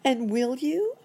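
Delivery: high, rising plea; high-pitched, hopeful question; hopeful cry. A narrator's reading. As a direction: ask eagerly as the monkey doctor. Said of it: "cried Doctor Monkey Doodle".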